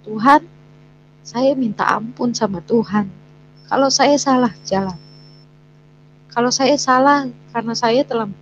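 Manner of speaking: half-relived tearful plea to God; retold tearful prayer. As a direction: plead with God as if crying again. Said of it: "saya nangis".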